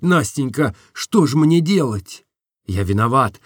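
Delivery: ordinary delivery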